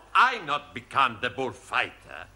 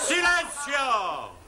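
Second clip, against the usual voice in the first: high, long drawn-out shout; shout for silence; 12 semitones higher, much slower than usual. Acted. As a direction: shout the room down, stretching the word. Shouts the class quiet in Spanish.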